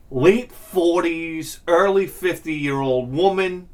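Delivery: slow, deliberate description; slowly building the comic picture; drawn out. Real, not acted. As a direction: stretch each word for effect.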